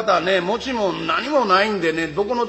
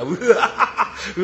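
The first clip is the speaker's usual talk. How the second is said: usual speaking voice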